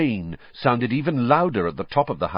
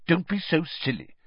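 higher, dismissive mum; Mrs Weasley; 9 semitones higher than usual. A narrator's reading. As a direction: brush off a silly son. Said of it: "said Missus Weasley".

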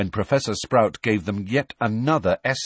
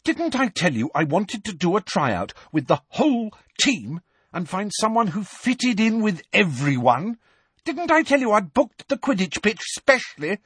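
furious captain; 12 semitones higher than usual. A narrator's reading. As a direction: tear into him with rising fury. Angelina shouts at Harry.

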